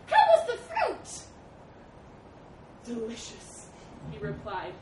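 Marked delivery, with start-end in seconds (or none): 0.0-1.2 high, loud squeaky hail; calling animal. Acted. Animals waiting by the bridge, high calling out. 2.9-3.7 small, uneasy reply; nervous, quiet. A narrator's reading. Elephant replying from the groaning bridge, quietly nervous. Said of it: "nervously, quietly".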